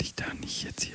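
hushed unvoiced whisper. Real, not acted. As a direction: whisper it under your breath. Aside whispered away from mic.

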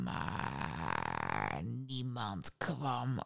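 low djinn, slowing right down on the last words; brooding genie, trailing off very slowly. A narrator's reading. Djinn repeats his story. (lage djinnstem, traag wegstervend)

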